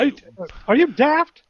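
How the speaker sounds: high indignant British put-down; British accent. Acted. Player mock British insult.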